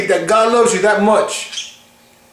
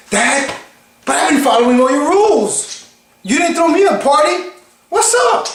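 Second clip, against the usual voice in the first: jealous son protesting, pitch climbing high; indignant complaint; 5 semitones higher than usual. Acted. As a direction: whine to dad about unfairness, rising to a squeak. Acted complaint of the jealous brother.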